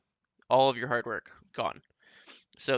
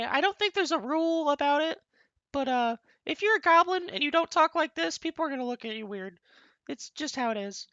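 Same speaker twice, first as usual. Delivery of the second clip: playful goblin demo, pitched way up. Shown as a demonstration. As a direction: squeak it out like a greedy little goblin. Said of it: "Okay, enough of that".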